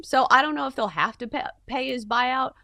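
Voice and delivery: ordinary talk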